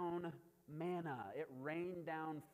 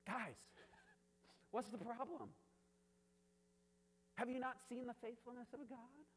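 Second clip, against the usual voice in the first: high-pitched mock disbelief; exasperated Moses; 6 semitones higher, 4 dB quieter than usual. The leader to his grumbling people, exasperated disbelief. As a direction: plead with them, pitched up, half laughing. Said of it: "the leader? He's like".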